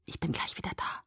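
unvoiced soft whisper. A narrator's reading. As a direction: whisper it close to the ear. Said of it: "flüsterte er mir ins Ohr".

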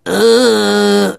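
high, loud drawn-out hesitation; stalling. Acted. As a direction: stall on one long high sound. Harry doesn't know the password.

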